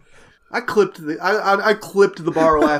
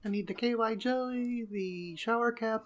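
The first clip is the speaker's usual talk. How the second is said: small, higher voice of a sheepish customer; hushed shopper request; 3 semitones higher, 12 dB quieter than usual. Acted. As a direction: ask for it quietly, stretching the items out. Acts out embarrassed shopper's request.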